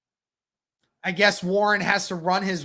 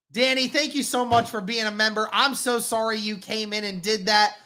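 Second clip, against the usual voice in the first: ordinary talk, nothing marked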